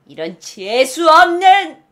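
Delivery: loud, high, slowed cursing; indignant, stretched-out outburst; acted insult. Shown as a demonstration. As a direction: spit it out with rising outrage. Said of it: "이라는 표현이 됩니다".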